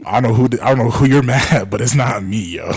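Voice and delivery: mock exasperated protest to an angry caller. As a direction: protest loudly to a ranting stranger.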